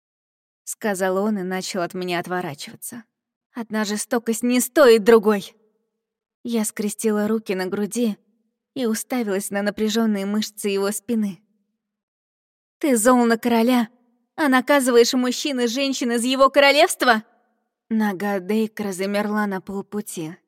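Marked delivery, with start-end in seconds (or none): 3.5-5.5 louder, indignant retort; angry outburst. Acted. Heroine retorts as he turns away. 12.8-17.2 higher, louder accusing demand; indignant. Acted. Heroine accuses her captor.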